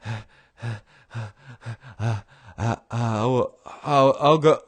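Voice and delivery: panting, out of breath. Retold or imitated. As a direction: puff the words out breathlessly. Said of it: "he's out of breath".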